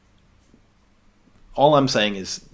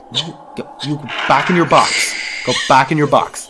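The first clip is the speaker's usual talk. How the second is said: firm, louder telling-off; scolding command; 2 semitones higher, 6 dB louder than usual. Pretend. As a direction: order it back like a pet. Ordering something back in box.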